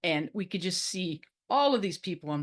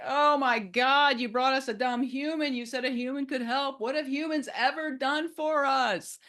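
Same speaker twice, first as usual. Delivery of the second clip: mock yelling; 8 semitones higher than usual. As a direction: yell in protest like an alarmed crowd of turtles. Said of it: "all the other turtles just start yelling".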